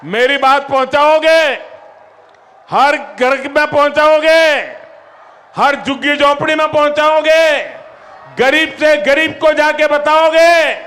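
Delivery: high, loud questions to a crowd; rallying shout. Real, not acted. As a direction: rouse the crowd to answer back. Asking crowd to respond.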